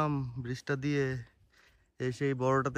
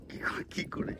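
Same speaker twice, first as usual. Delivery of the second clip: whispering; 9 dB quieter than usual. Real, not acted. Hushed aside on the bridge.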